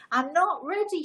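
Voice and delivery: high, panicked wail of a daughter in labour; cries out. Retold or imitated. Daughter in labour to her mum, crying out in fright. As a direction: cry it out, frightened. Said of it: "she cries".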